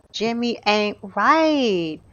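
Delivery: slow, drawn-out verdict; emphatic disapproval. Real, not acted. Gamer judges Jimmy for leaving, drawn-out disapproval. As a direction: stretch RIGHT out long.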